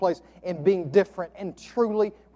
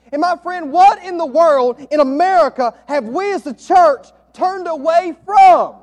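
rising, louder pulpit preaching; preacher building to a shout for the church; fiery preaching; 9 semitones higher, 9 dB louder than usual. Acted. Preaching at full force.

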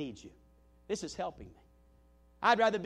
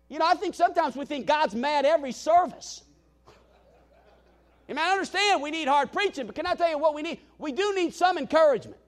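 preaching; 7 semitones higher, 7 dB louder than usual. Acted. Preaching to congregation.

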